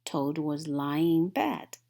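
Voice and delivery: ordinary delivery, nothing marked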